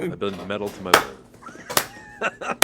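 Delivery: low, slow villain line. Acted. Magneto declaring his power, slow low villain voice. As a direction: draw it out low and ponderous. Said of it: "[name] as, as Magneto".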